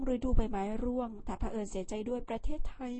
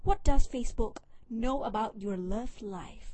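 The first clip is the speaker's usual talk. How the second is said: slow, deliberate retake in a more native English accent; careful English accent; 6 dB louder, much slower than usual. Shown as a demonstration. Vlogger retries the English title after botching it, slow careful English accent. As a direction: redo it with the best English accent you can manage. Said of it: "เอาใหม่".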